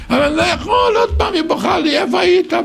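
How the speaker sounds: high, complaining husband's whine; high plaintive moan; whiny husband. Acted. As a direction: whine like a put-upon husband.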